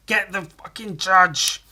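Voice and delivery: angry Muppet shout. Acted. Angry Muppets call to rush the judge, loud and slow. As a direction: whip up the mob, each word slow. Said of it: "And Angry Muppets".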